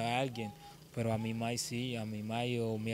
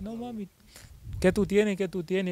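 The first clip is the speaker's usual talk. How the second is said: worried mother; 7 semitones higher, 3 dB louder than usual. Retold or imitated. Mother voiced asking again.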